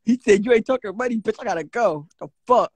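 usual speaking voice

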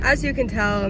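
normal talking voice

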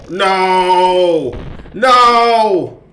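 high, loud cries of panic; panicked no. Real, not acted. Gamer cornered by a monster, panicked shouts. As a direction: shout it in real fear.

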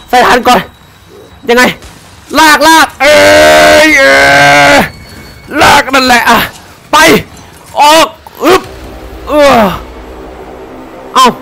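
high, loud panicked yelling; panicked shouting. Real, not acted. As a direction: yell in real panic while fleeing. Escaping chase.

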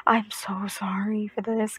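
slow whispered apology; sister-in-law apologizing in a hushed whisper; quoted whisper. Retold or imitated. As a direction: breathe the apology out softly. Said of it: "my sister-in-law is like".

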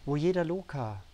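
measured, emphatic poetry reading with deliberate pauses; poem recitation. Acted. Poet reads an emotional poem to viewers, solemnly reciting. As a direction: lift each questioning refrain, then let it fall.

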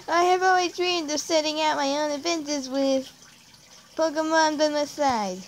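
high, put-on cartoon hero voice; Ash Ketchum. Gamer voices a trainer's dream of adventure, Ash Ketchum voice. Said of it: "Ash, like, Ash Ketchum-like voice".

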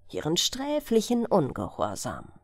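ordinary delivery

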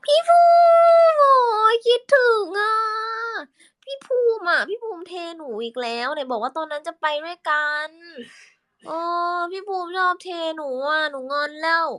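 very high, loud sulky cutesy whine; whiny little sister voice. Shown as a demonstration. Sulking at big brother Phum in a very high, whiny, cutesy voice. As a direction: pout and whine up high like a spoiled kid. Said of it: "คุยกับพี่ภูมิเสียงไหน".

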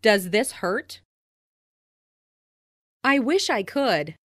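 normal talking voice

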